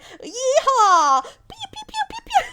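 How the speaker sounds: a hammed-up high cowboy whoop with gun noises; cowboy yeehaw. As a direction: play a silly gun-toting American. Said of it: "and like".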